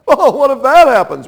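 loud, high anxious question; mock worrier. Preacher voicing a worried listener, high and loud. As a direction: fret out the what-if. Worrier voiced in back and forth.

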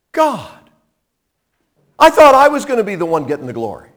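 high, loud, rushed protest; mock complaint. Deborah supposedly protesting her lost glory, mock complaint. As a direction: whine to God as a hammed-up sore loser.

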